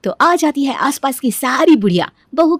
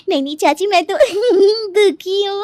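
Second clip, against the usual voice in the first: high giggles breaking up the apology; helpless laughing; 10 semitones higher, slower than usual. Acted. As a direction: try to apologise but keep cracking up. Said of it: "इस बात पर ये हंस रही है". (माफ़ी माँगते हुए बेकाबू हँसी, ऊँची आवाज़)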